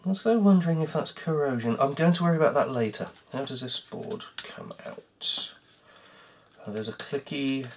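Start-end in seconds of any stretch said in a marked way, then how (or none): 3.3-7.7 whispered mutter. Real, not acted. Muttering to himself over the board.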